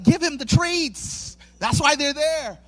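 high, slow, emphatic instruction; imitating an exasperated wife. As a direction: spell out the obvious, fed up. Wife's exasperated text acted out.

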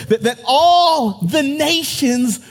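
climbing preacher's cadence; preaching. Acted. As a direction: build the call up to a shout of praise. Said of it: "I feel like preaching".

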